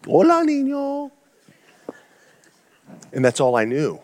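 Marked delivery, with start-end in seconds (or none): 0.0-1.1 slow, careful tourist Spanish, rising high on "Hola"; a lightly voiced, unsure greeting to a small boy; mock-sweet foreigner trying Spanish; awkward gringo Spanish. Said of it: "and I'm like".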